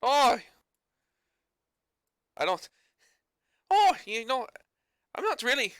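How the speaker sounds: a high, loud Newfie ape drifting toward Irish; reaching for the Newfie accent and slipping. Acted. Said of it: "trying to slip into Irish or Scottish".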